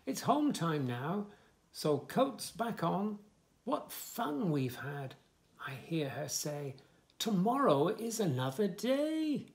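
lively, sing-song story reading; reading to children. Acted. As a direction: bounce the rhymes for little listeners. Reads book text.

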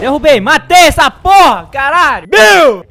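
loud victory yell; triumphant shout. Real, not acted. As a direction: shout the kill out. Celebrating a kill, shouting.

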